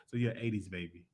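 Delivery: normal talking voice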